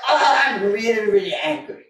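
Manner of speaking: a hint of hammed-up anger, pushed out with the punch; mock anger. Shown as a demonstration. Teacher demonstrating the punch to the class, mock angry. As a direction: act the anger to work the liver. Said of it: "I have to feel angry".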